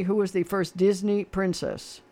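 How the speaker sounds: ordinary talk, nothing marked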